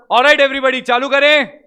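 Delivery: loud, high call to get the class started. Real, not acted. Teacher calling the class to begin, loud and high. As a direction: raise your voice to pull the whole room in.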